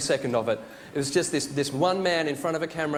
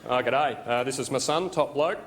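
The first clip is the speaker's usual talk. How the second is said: put-on broad laconic Australian accent, lower pitch; Australian bloke; 5 semitones lower than usual. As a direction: play an Aussie dad introducing his kids. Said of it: "imagine an Australian guy naming his kids".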